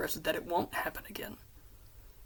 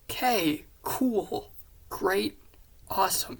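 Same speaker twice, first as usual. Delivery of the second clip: loud, separate one-word reactions; higher, falling, overdone acknowledgements; stunned acceptance; 4 semitones higher, 6 dB louder, slower than usual. Retold or imitated. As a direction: punch each word with a gap between.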